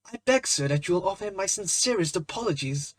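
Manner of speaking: a hint of a stammering young man apologising. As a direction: hurry the apology out, embarrassed. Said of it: "stammered Hughie".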